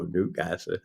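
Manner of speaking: "Newcastle" in soft, low mock Geordie; Newcastle accent. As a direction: drop "Newcastle" quietly with a Geordie short a. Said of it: "I love the Newcastle accent".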